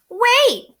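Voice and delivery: high, loud cry of wait. Acted. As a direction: call out to stop him leaving. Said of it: "cried Bear".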